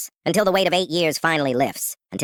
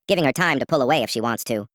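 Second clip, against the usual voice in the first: ordinary delivery, nothing marked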